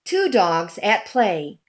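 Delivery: ordinary delivery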